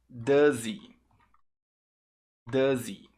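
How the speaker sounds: "Does he" run together as "duh-zee"; linked, silent H. Shown as a demonstration. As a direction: drop the H in "Does he", Z gliding into "ee". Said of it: "Você junta o som do Z, o H fica mudo".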